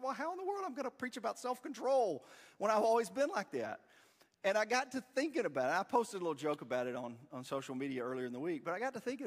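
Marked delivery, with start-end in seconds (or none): 0.0-3.8 high-pitched incredulous question; exasperated protest. Retold or imitated. Son to mom before preaching on self-control, exasperated. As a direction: protest in rising disbelief.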